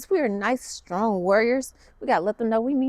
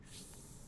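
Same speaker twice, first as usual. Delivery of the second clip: soft unvoiced cobra hiss; snake hiss; 28 dB quieter than usual. Shown as a demonstration. Yoga teacher showing kids the cobra, soft snake hiss. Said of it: "Turn to the other side and say".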